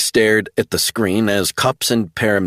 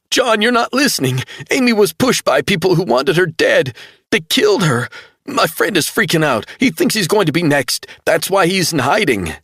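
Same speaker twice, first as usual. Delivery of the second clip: much higher, urgent pleading; desperate insistence; 11 semitones higher, 3 dB louder than usual. A narrator's reading. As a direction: make him believe you. Travell insisting.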